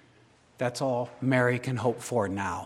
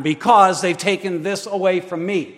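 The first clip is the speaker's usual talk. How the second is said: loud, higher lament to God; anguished cry; 6 semitones higher, 12 dB louder than usual. Acted. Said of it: "we say back to him".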